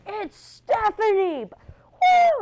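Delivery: very high, loud, drawn-out cheer; hollering like a proud dad at a game; imitating cheering dad. Dad shouting from the crowd, a hammed-up high cheer. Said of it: "my dad was like".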